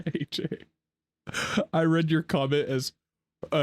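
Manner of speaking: normal talking voice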